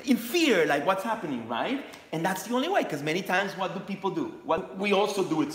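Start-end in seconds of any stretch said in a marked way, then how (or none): 0.0-1.8 higher, searching self-questions; inner questioning voice. Retold or imitated. Host voicing a viewer's inner questions, a little higher. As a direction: ask yourself what is wrong. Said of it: "you can see, like".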